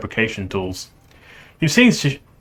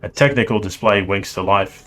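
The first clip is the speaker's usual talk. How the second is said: ordinary delivery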